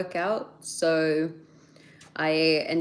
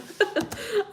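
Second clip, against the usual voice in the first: high laugh. Real, not acted. Amused at her own answer.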